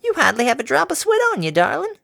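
put-on high, cheery elderly mare; a sweet old farm granny offering water. A narrator's reading. Granny offering water to the workers, high and cheery.

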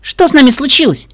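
high, loud worried question. A narrator's reading. Mirror Pinkie asks her host, worried. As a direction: ask it in high-pitched concern. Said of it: "посмотрела на мою хозяйку с беспокойством".

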